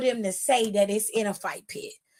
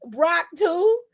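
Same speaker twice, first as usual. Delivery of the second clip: higher, questioning repeat of Brock too, not shouted; echoed disbelief; 6 semitones higher than usual. Real, not acted. Repeats the question more softly.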